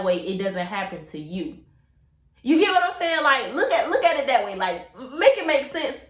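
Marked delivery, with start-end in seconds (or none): 2.4-3.2 much higher and louder, pressing the listeners; emphatic question. Real, not acted. Vlogger pressing her listeners to get it, urgent and loud. 3.2-6.0 urging. Real, not acted. Urging listeners to see it.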